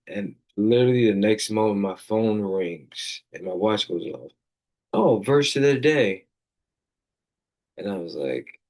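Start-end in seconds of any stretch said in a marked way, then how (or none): 4.9-6.2 higher, louder surprised "Oh". Retold or imitated. His watch buzzes right after praying, surprised. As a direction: react as the phone lights up unexpectedly.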